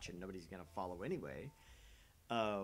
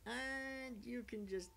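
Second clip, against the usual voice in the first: mocking quote; 11 semitones higher, slower than usual. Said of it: "They're like".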